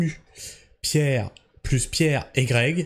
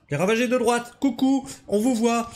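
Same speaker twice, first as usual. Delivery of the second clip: higher, taunting little wave-hello voice; teasing singsong; 6 semitones higher than usual. Acted. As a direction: tease them like kids caught out. Teasing the right-wingers.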